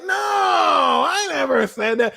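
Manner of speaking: high, drawn-out protest of innocence; mock denial. Liars confronted about what they said, mock denial. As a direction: stretch it into a high-pitched fake denial. Acts out liars caught denying it.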